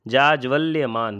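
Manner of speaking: जाज्वल्यमान said slowly with a doubled ल्ल in ज्वल्य; slow careful spelling. Shown as a demonstration. Teacher spells out a hard word for students, slowly. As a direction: drawl जाज्वल्यमान out carefully for the class. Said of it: "ये वर्तनी देख लीजिए".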